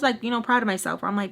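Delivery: normal talking voice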